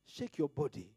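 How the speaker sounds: normal talking voice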